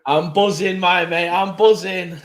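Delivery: hyped catchphrase for the viewers; buzzing. Acted. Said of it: "That's for everyone watching".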